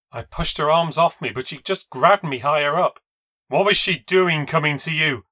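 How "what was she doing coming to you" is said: angry demand. Acted. Rose shaking narrator.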